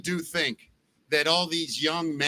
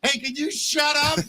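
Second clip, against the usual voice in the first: high, snappy mock robot voice; mock robot girlfriend; 9 semitones higher than usual. Host imagining a robot girlfriend who snaps at him, high and mocking. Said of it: "until one can go like this".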